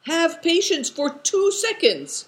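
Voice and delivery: high, loud respectful hail; calling out. A narrator's reading. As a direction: hail someone across a distance. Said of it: "he called out".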